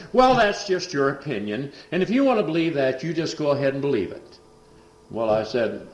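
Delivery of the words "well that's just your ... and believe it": higher, louder scornful brush-off; mock dismissal. Son dismissing the preacher's faith, higher and louder. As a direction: wave him off with scorn.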